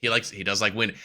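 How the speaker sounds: normal talking voice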